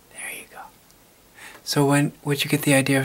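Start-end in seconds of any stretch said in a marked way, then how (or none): 0.1-0.6 soft unvoiced whisper; secretive whisper close to the mic. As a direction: hush it like parents are asleep.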